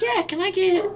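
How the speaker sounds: very high put-on kid voice; imitating young self. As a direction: order like an eager little kid. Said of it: "I was a kid".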